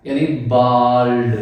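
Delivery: बाल्ड said slowly with a long open aa; long aa sound. Shown as a demonstration. Teacher showing students the aa sound in बाल्ड. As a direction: stretch the aa in बाल्ड. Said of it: "यहाँ पे इसका sound बनेगा आ".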